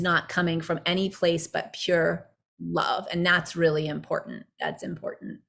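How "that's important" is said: breathy whisper echoing the point; whispered emphasis. Real, not acted. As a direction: whisper it as a quiet echo. Whispered echo of own point.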